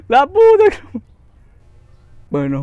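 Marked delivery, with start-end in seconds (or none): 0.1-1.0 very high, loud cut-off curse; furious outburst. Real, not acted. Gamer cursing as he dies. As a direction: explode and break off.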